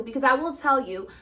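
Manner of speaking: ordinary talk, nothing marked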